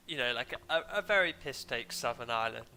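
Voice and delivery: normal talking voice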